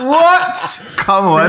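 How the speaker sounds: high squeak through a laugh; laughing what. Real, not acted. Reacting to co-host's laugh, laughing high. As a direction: burst out laughing on the word.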